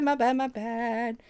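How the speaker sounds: usual speaking voice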